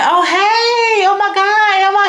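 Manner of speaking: very high squealing delight; shrieking girlish excitement; excited squeal. Retold or imitated. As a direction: squeal back, thrilled and flattered. Speaker squeals back in delight.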